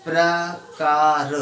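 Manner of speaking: प्रकार drawn out very slowly, each sound apart; teacher stretching प्रकार for pupils; slow-motion pronunciation. Shown as a demonstration. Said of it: "मेरे साथ साथ उच्चारण कीजिए".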